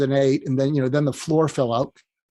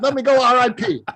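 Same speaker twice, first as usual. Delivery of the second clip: high, impatient Brooklyn accent impression; Larry King; 8 semitones higher than usual. Dead mentor reassuring Mark, exasperated Brooklyn accent. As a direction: reassure him gruffly in comic Brooklynese. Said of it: "in his Brooklyn accent".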